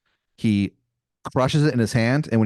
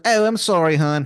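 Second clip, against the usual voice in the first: higher-pitched, hammed-up Maryland accent; Wizard of Baltimore, bad Maryland accent; 6 semitones higher, 3 dB louder than usual. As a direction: put on a terrible Maryland twang. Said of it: "this man's accent is something".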